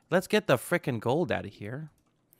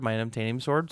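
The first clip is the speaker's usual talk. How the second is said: ordinary talk, nothing marked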